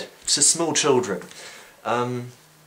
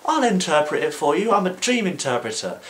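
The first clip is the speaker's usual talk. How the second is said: eager helper; 7 semitones higher than usual. Retold or imitated. Joseph boastfully offers help.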